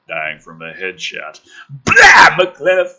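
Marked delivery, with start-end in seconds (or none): 1.7-2.3 loud high mock splatter sound; splat noise. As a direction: blurt it like a gore effect. Headshot sound effect.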